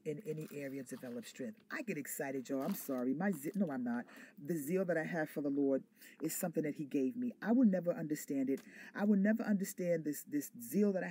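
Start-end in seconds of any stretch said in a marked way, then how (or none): none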